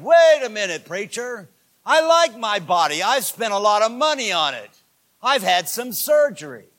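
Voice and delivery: loud, high mock protest; indignant interruption, imitated. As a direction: cut in like an affronted visitor. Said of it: "she immediately spoke up".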